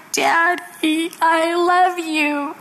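put-on high, slow little-girl voice; acted tearful child. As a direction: imitate a sad child, drawing the words out. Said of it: "she's crying. She's sitting on the bed sobbing".

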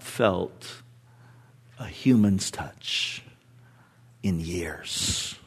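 whispered. Real, not acted. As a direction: drop to a whisper on "in years". Hushed emphasis.

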